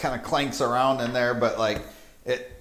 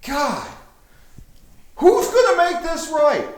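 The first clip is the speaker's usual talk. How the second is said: very high, loud, frantic screeching; shrieking panicked necromancer; 7 dB louder than usual. Acted. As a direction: shriek in total disbelief at the loss. Necromancer wails.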